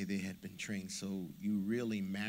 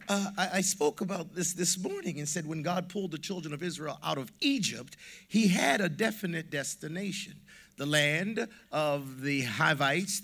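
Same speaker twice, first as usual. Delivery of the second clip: louder and higher, rising preacher's fervor; full-force preaching; 6 semitones higher, 8 dB louder than usual. Acted. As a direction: preach it out to the congregation.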